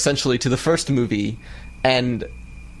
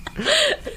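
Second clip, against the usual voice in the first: high squeal laugh. Real, not acted. Squeal at own mistake.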